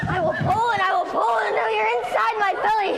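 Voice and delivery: gloating crocodile roar. Acted. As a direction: tug hard and gloat. Crocodile tugging the child.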